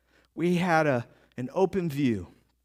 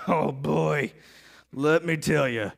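weary disapproval; 7 semitones lower, slower than usual. Acted. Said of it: "they go".

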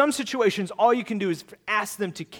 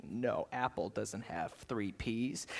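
low, slow, hushed mutter; acted secretive murmur; furtive self-talk; 9 semitones lower, 14 dB quieter, slower than usual. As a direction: mumble it low to yourself while checking. Acting out himself sneaking answer check.

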